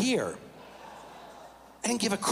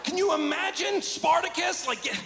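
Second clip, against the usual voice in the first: comic outrage; 12 semitones higher than usual. Real, not acted. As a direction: shout it up high like you can't believe it. Comic rant about real men.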